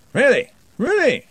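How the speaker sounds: high loud astonished exclamation; amazed double take; surprised. A narrator's reading. Ridcully astonished.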